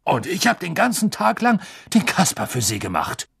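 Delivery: a touch of wounded outrage; indignant. Acted. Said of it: "sagte Fudge empört".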